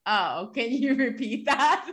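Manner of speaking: drawn-out sheepish plea with a giggle; sheepish, laughing request. Retold or imitated. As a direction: act caught out, laughing through the question. Retold sheepish request after being caught.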